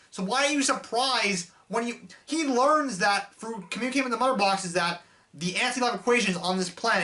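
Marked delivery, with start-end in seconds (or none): none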